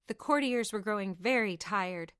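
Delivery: ordinary delivery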